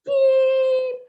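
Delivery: mock censor beep, high and loud; high loud bleep sound. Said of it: "And he'd say".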